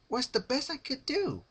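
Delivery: high, bubbly, sing-song; cheery Pinkie voice. A narrator's reading. Pinkie asking her captive for advice, high and bubbly. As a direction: chirp it like a sweet party pony.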